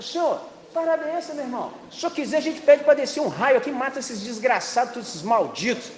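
high-pitched, worked-up disciples; indignant disciples. Retold or imitated. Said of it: "os caras ficaram tão indignados".

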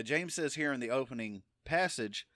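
usual speaking voice

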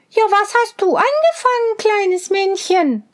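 helle, hohe Mädchenstimme; hoch und neugierig fragen; fragendes Mädchen. A narrator's reading. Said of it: "fragte Rosenrot". (Rosenrot fragt den Zwerg, hoch und hell)

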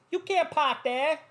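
high, drawn-out Boston accent impression. Heckling a driver in the commercial, mock Boston accent. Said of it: "they're fucking talking in that Boston accent".

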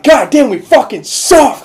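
mock frustrated fan. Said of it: "I'll be like".

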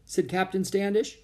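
usual speaking voice

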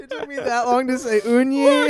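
high, laughing. Real, not acted. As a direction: talk through laughter. Laughing at result.

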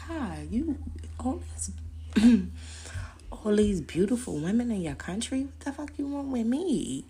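high, giddy mock disbelief; flattered disbelief. Asking the handsome guy why her, high and giddy. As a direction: gush up high in flattered shock. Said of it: "I'm so like".

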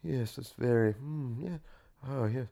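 hushed, murmured listening noises; very quiet, rapt agreement; mock radio interviewer. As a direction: softly hum along with mock fascination. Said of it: "They do that a lot".

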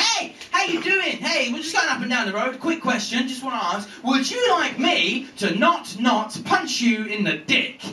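high, smooth-talking salesman patter; salesman type. Acted. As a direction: pitch it like a doorstep salesman. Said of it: "he's a salesman type".

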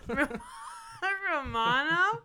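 high laughing squeal of disbelief; can barely get it out for laughing. Real, not acted. Teasing her friend, squealing through laughter in disbelief.

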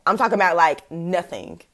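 ordinary talk, nothing marked

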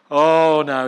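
loud alarmed oh no. Retold or imitated. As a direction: burst out in alarm. Peter alarmed at Jesus.

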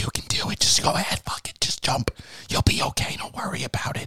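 mocking whispered pep talk; hushed guardian angel urging a jump; Matthew Lillard. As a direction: whisper a casual, dismissive reassurance. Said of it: "Matthew Lillard's like".

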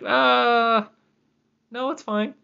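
very high, awkward reassurance; host reassuring scared visitors, high and sheepish; mock sheepish. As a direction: squeak out a nervous excuse.

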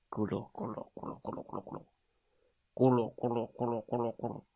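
breathy rumbling ku-lu sounds; bear tummy rumble. A narrator's reading. Narrator imitates a hungry bear's rumbling stomach, soft gurgle. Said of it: "咕噜咕噜的声音".

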